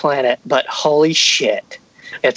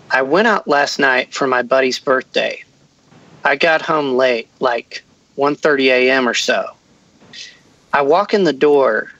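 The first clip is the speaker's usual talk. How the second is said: ordinary talk, nothing marked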